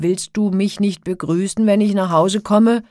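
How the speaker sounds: loud, demanding giant; shouting. A narrator's reading. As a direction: yell it as an offended giant. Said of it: "schrie er". (laut schreiender Riese)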